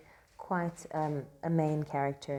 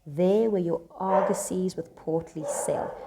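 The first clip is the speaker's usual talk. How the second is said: louder, slow declaimed verse; dramatic reading; 3 semitones higher, 6 dB louder, slower than usual. Acted. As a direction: declaim the line grandly. Rereads Salerio's line.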